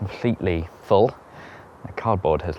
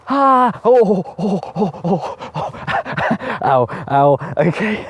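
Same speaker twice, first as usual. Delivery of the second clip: high, loud ohs and ows breaking into laughter; real pained yelps; 12 semitones higher, 6 dB louder, slower than usual. Real, not acted. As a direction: yelp from a knock on the knee. Brick smacks into his knee.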